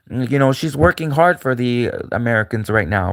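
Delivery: ordinary delivery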